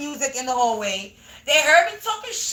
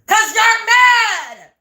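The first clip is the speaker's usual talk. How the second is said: slow, drawn-out shout of mad, higher and louder; taunting shout; 4 semitones higher, 5 dB louder, much slower than usual. Real, not acted. Taunting the angry neighbors, slow mocking shout. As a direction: rub it in with a slow yell.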